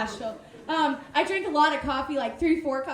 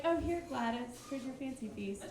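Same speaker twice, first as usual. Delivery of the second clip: meek fawning; 3 semitones lower, 12 dB quieter than usual. Retold or imitated. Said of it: "And I was like".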